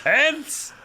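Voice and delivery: high hammed-up shocked yelp; mock dramatic cry. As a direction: ham up the cliffhanger. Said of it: "mocking the book ending".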